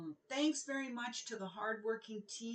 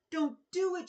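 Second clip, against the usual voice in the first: high, loud shout of warning; 8 semitones higher, 8 dB louder than usual. Acted. Crowd calls after the princess, loud high warning shout. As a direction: cry out to stop her. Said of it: "shouted the people of the kingdom".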